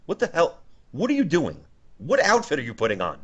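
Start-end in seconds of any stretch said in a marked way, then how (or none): none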